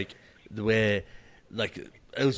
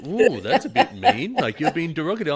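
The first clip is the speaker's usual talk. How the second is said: mock disapproval; 6 semitones higher, 4 dB louder than usual. Everyone objecting to his "hey ladies", mock disapproval. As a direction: chide teasingly, pitched up, with a laugh. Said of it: "Everyone's like".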